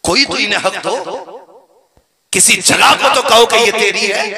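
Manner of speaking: loud, indignant preacher's exhortation; impassioned appeal. Real, not acted. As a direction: cry out to the congregation, building to a shout. Impassioned appeal for daughters' rights.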